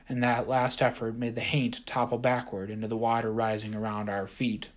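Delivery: ordinary delivery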